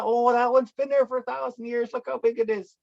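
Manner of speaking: put-on high-pitched kid pointing out a big tree; imitated kids. Kid showing uncle an old tree, imitated in a high voice. Voicing kids guessing the trees' age.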